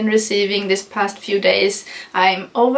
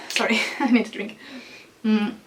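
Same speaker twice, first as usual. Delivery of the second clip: normal talking voice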